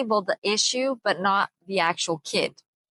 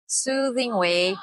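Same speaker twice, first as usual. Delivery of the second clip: normal talking voice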